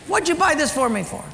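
scornful dad. Retold or imitated. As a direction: sneer at the gift like an annoyed father. Father ranting at gift.

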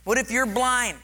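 ordinary delivery

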